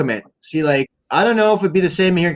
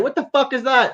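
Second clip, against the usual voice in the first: a boss yelling at a worker on the site, pitched higher; mock angry boss; 9 semitones higher than usual. Foreman catches worker smoking on the job, high mock yell. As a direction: bark it like a boss who caught you slacking.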